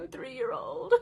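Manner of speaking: choked up, high and teary; tearful catch; voice breaking. Real, not acted. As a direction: let the words crack with real tears. Emotional about youngest turning three.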